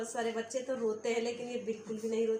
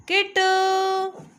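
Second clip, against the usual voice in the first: high, long drawn-out call of Kittu, louder; 9 semitones higher, 12 dB louder, much slower than usual. Real, not acted. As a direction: stretch Kittu slowly and high to catch her attention. Calling the baby's name. (Kittu को ऊँची खिंची आवाज़ में पुकारना)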